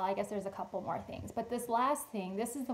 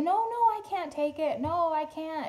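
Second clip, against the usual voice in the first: lady protesting; 7 semitones higher, 5 dB louder than usual. Retold or imitated. Lady refuses the gift towels.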